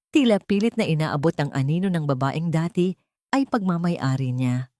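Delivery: ordinary delivery, nothing marked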